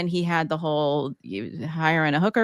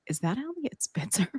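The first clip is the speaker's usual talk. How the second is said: hushed aside; 3 semitones higher, 8 dB quieter than usual. Retold or imitated. Spotting celebrity beside husband.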